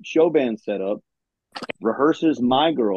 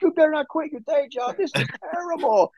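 mock heckling; 12 semitones higher than usual. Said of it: "and they're yelling".

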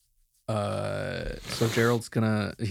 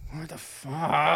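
groggy shock; 4 semitones higher, much slower than usual. Acted. Woken by knocking at the door.

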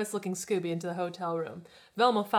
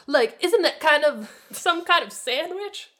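Shaggy voice; 8 semitones higher, 8 dB louder than usual. A narrator's reading. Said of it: "Shaggy asked".

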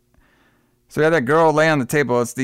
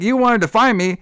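higher, lightly dramatized film line; acted-out character; 8 semitones higher, 3 dB louder than usual. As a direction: act out the character a bit higher. Retold film dialogue, acted out.

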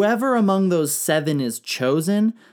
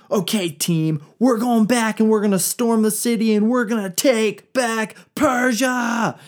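high, loud call to arms, pushing faster; rallying war cry; 7 semitones higher, 3 dB louder than usual. Acted. King rallying his army to retake Persia, loud shout. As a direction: fire up the troops to storm the city.

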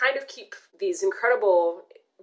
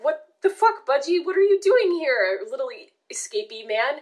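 surprised; 8 semitones higher than usual. Retold or imitated. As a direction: voice jumps up in amazement. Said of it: "We're just like".

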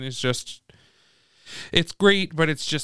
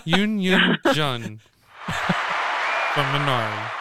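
very slow, drawn-out announcement; slow dramatic reveal; much slower than usual. Acted. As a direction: stretch every word like a big reveal. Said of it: "they just were floored at that".

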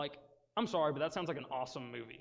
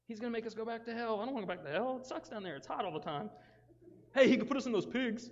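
whiny demons; 6 semitones higher, faster than usual. Acted. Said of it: "they were like".

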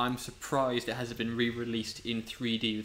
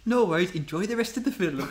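put-on raised, sing-song Welsh accent; thick Welsh accent; 6 semitones higher than usual. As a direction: imitate a cheery Welsh usher signing off. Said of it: "he had a very thick Welsh accent".

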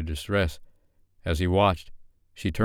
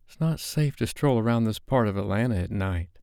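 Sophie, anxious; 4 semitones higher than usual. Acted. Sophie objects.

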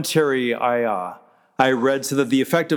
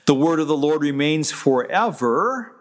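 ordinary talk, nothing marked

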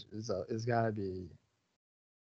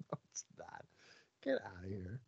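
dismissive mutter; 5 semitones lower, 17 dB quieter, faster than usual. Real, not acted. Dismissive retort after laughing.

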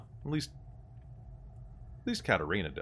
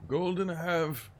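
slow, higher innkeeper voice; 9 semitones higher than usual. Acted. Innkeeper NPC voice.

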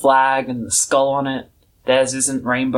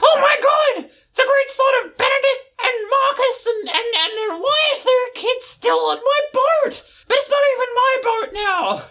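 much higher, wailing panicked rant; frantic shouting. Retold or imitated. Old Smoky rants about the boat, high panicked wailing. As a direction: squeal it out in a frantic, half-crying panic. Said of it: "shouting about everything".

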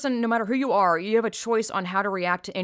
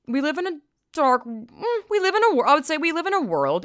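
heated rebuttal; 7 semitones higher, 4 dB louder than usual. Retold or imitated. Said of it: "I'm like".

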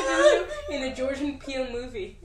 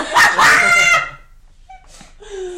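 shrieking laugh; 13 semitones higher, 14 dB louder than usual. Real, not acted. Laughter fit.

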